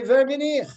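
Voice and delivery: Wer bin ich said high and loud in Yiddish; Yiddish phrase. Shown as a demonstration. Teacher to class, saying Wer bin ich in Yiddish. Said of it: "In Yiddish, we say".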